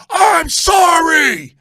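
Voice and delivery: slow, loud, high mock-furious shout of "sorry"; hammed-up angry fan yelling sorry; angry apology. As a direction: spit the apology out angrily while cracking up. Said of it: "with the same anger".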